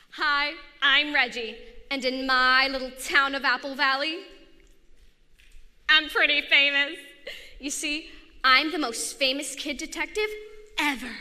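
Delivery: put-on cocky kid detective, talking up his fame; proud little sleuth introducing himself; boy detective voice. Narrator plays Reggie the boy detective.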